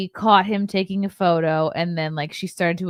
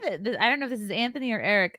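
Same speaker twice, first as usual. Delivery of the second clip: usual speaking voice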